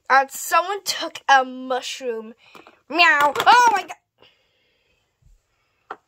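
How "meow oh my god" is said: loud meow then a shocked yelp; loud startled cry. Pretend. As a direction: yelp it out as the cat pounces. Kitty attacks in toy play.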